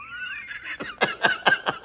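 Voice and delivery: teller's own cackle; high laugh. Real, not acted. Comedian laughs at his own joke.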